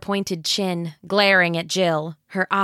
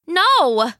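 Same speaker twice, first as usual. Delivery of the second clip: loud, higher panicked cry; frantic no; 9 semitones higher, 6 dB louder than usual. Acted. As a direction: cry out in horror. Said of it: "Anne said, wild-eyed".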